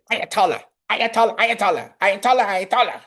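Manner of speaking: high, rapid-fire comic popping voice; whack-a-mole pop-ups. Pretend. Host mimicking a whack-a-mole game, high comic pop-ups. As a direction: pop each name up high, like game targets.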